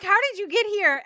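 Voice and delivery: high, fast surprised question; surprised friends. Retold or imitated. Friends startled to see Harry suddenly appear, surprised. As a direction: gasp out how he got there. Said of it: "they're like".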